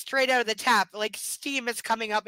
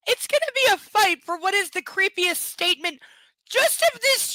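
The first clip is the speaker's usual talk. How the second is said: higher and louder, indignant ranting; outraged rant; 10 semitones higher, 8 dB louder than usual. Real, not acted. Reader rants to viewers about the line, outraged. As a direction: rant in disbelief at the creepy line.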